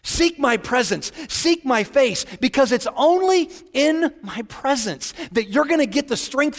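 fervent preaching. Acted. Pastor voicing God's plea, fervent. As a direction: press each phrase with passion.

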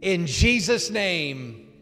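slow, loud prayer, line by line; loud and strong. Real, not acted. Pastor leads new believers in a prayer, loud and strong. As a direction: proclaim each phrase for the room to echo. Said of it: "loud and strong".

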